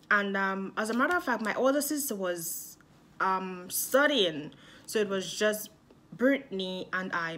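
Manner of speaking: normal talking voice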